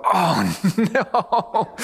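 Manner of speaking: laughing, dismayed oh no; laughing groan. Retold or imitated. Smart kids dreading group work.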